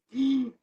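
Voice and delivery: a quick, startled intake of breath; sharp gasp. Acted. Speaker shows a sudden stress reaction with a gasp. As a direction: gasp as if stress just hit.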